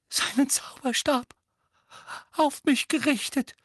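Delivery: high, murmured Dudley voice; Dudley mumbling. A narrator's reading. Dudley tells his parents, mumbling. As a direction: mumble it out shakily. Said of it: "murmelte Dudley".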